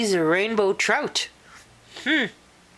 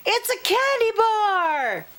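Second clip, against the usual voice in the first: much higher, thrilled; excited girl; 14 semitones higher than usual. Acted. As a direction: announce the treat gleefully. Cheryl excited by candy.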